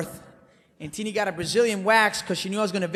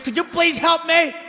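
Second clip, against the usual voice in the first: pleading; 9 semitones higher, 3 dB louder than usual. Retold or imitated. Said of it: "I was like".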